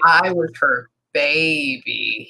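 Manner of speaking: slow, stretched-out cat talk; imitating a cat, taking its time; long drawn out. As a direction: draw the words right out as the cat.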